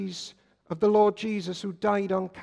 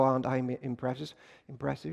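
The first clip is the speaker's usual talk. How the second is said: mock self-important boast; 5 semitones lower, 5 dB quieter, faster than usual. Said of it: "and go".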